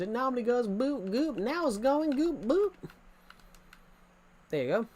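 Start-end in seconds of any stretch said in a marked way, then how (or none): 0.0-2.7 higher, slow put-on American accent. Shown as a demonstration. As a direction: show off your American accent, pitch climbing towards the end. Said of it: "Is my American accent all right?".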